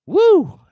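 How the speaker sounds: loud high whoop. Real, not acted. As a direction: let out a big cheer. Whoops after signing off.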